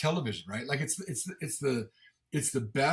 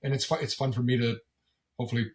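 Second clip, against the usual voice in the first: ordinary talk, nothing marked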